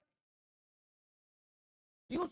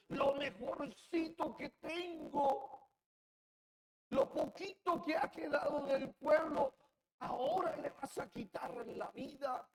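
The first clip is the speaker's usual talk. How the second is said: pleading outcry; 7 semitones higher, 5 dB louder than usual. Acted. Ezekiel's lament re-voiced.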